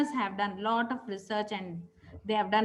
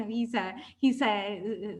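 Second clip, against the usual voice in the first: ordinary delivery, nothing marked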